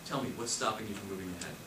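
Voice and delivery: quiet, quick, pointed probe; probing question. Shown as a demonstration. Coach modelling how to probe a hesitant buyer: quiet, quick. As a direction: lean in and dig softly.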